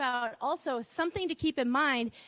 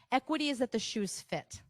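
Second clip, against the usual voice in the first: normal talking voice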